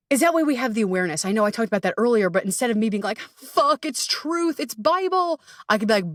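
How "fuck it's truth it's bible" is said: higher-pitched alarmed outburst, lightly done; half-joking freak-out, voice jumping up; mock panic. Imitating her anxious self in a higher mock panic.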